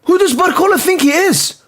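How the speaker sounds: high, incredulous demand; indignant disbelief. Real, not acted. Fan scolding Barcola for no run-up, high indignant disbelief. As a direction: scoff at his arrogance.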